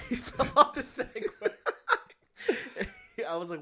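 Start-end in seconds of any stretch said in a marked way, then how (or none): 0.1-3.3 high, loud words breaking into laughter; laughing. Real, not acted. Telling co-host about the salesman's fall, laughing. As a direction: crack up while saying it. Cracks up telling the Segway story.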